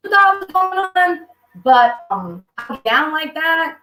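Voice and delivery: high, slow, teasing come-on voice; coy flirting. Retold or imitated. Coyly offers herself to rescuer.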